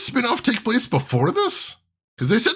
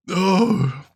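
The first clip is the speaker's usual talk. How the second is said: ordinary talk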